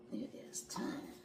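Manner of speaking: breathy whisper, it is time. Pretend. Whispered repeat.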